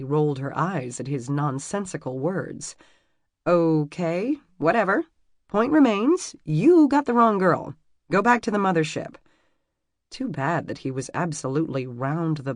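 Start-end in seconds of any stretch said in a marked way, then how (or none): 3.5-9.2 loud, high sarcastic dismissal; exasperated. Acted. Emmy mocking the stranger, exasperated and loud. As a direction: wave him off as a crackpot.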